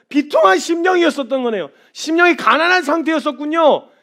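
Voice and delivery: loud, high, impassioned sermon cry; full-force preaching. Acted. Preacher crying out to the congregation, impassioned and loud. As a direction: preach it out with rising fervor.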